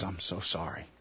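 softly, low and regretful, as the priest; very quiet voice. Acted. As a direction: deliver the bad news in a low, hushed voice. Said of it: "says in a very quiet voice".

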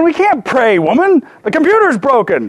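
brushing off his wife's idea to pray, laughing mock exasperation. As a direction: play the exasperated husband while cracking up. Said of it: "I was going".

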